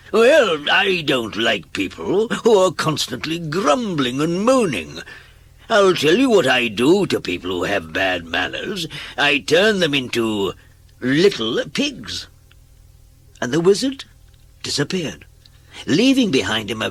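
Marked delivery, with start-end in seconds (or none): 0.1-10.5 loud, high wizard lecturing a grump; scolding wizard. Acted. Wizard scolding Mister Grumble. 11.0-12.3 slow, drawn-out wizard threat. Acted.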